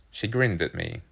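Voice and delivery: plain reading voice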